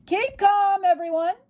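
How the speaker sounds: high, loud bellow over a crowd. Acted. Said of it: "bellowed C J".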